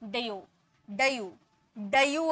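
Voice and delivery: डयू split as "da-yoo"; wrong two-part डयू, repeated; missing ee sound. Shown as a demonstration. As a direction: put a short uh after d in डयू. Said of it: "अगर ई नहीं आप लगाते".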